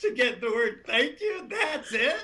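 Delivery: high, incredulous protest through amusement; indignant disbelief. Retold or imitated. Traveler complaining to guru about the tiny mantra, indignant disbelief. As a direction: protest in comic disbelief, pitch climbing.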